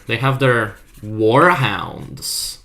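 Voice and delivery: slow, drawn-out "their warhounds" with a falling end; slow, stressed reference line. Shown as a demonstration. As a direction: lean on "their" and let "warhounds" fall slowly. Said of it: "I could make a little reference here".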